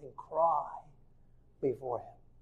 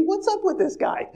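much higher, incredulous voice of a bewildered brother; baffled brother; 4 dB louder than usual. Retold or imitated. Brother reacts to weeping Joseph, high and incredulous. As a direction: squeak it out in disbelief.

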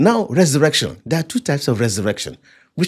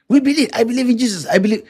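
high-pitched imitation of eager believers; mimicked professions of faith; 11 semitones higher, faster than usual. Preacher mimicking believers who claim faith, high-pitched. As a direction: recite it high, as others' easy claim.

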